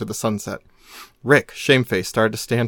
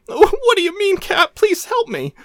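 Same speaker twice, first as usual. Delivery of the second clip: frantic plea. Acted. Rick begging Cap.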